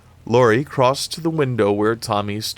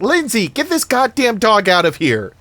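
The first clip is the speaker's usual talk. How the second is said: high, loud, frantic cries at the dog; yelling in pain; 13 semitones higher, 7 dB louder than usual. Acted. Annie yells at the dog tearing at her.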